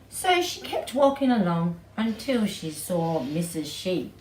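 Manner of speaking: plain reading voice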